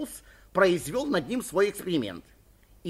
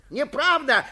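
loud, high-pitched denial; agitated high shout; 11 semitones higher, 8 dB louder, slower than usual. A narrator's reading. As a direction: cry out in desperate protest. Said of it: "кричал Суханок".

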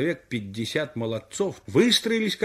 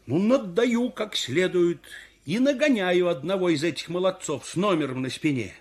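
plain reading voice

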